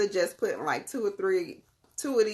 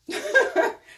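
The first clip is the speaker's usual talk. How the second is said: high laugh; 8 dB louder than usual. Real, not acted. Laughing at her own tears.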